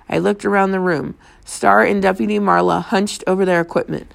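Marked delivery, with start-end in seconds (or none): none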